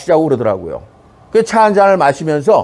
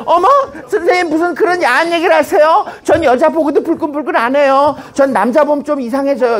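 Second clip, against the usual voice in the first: higher-pitched mock-shocked student; high, hammed-up "oh my, teacher!" voice; coy student; 7 semitones higher than usual. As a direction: act a coy pupil protesting to teacher in a high voice. Voicing a coy student's protest.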